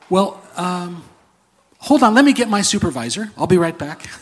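a flustered angel running off to fetch his supervisor; angel clerk. Retold or imitated. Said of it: "And they say".

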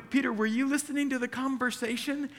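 high-pitched incredulous question; exasperated, high-voiced ribbing; mock disbelief. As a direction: playfully take Peter to task. Preacher scolds Peter in mock disbelief.

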